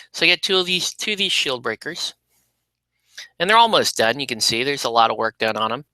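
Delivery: ordinary talk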